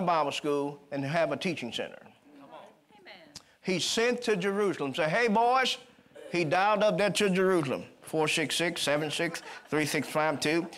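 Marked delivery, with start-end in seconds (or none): 5.1-5.8 put-on "Hey, boys" called high and loud; excited calling out. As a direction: call them up with excitement. Philip phoning Jerusalem.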